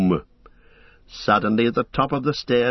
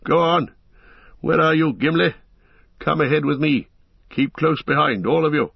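raised, urgent calls into the dark; urgent calling; 5 semitones higher than usual. A narrator's reading. Gandalf calling Gimli and the company, raised. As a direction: call them along firmly.

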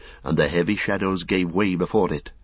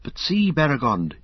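higher, alarmed call to his companion; frightened cry; 7 semitones higher than usual. A narrator's reading. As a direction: cry out in dread at the sky. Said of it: "he cried".

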